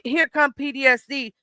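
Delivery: usual speaking voice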